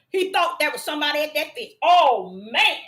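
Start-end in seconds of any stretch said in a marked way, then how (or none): none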